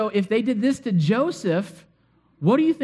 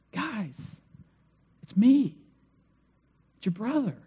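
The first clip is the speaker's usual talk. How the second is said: soft, then gently lifting; gentle and close, as if to family; tender reveal; 2 semitones higher, 4 dB quieter than usual. Retold or imitated. Joseph gently telling his brothers who he is.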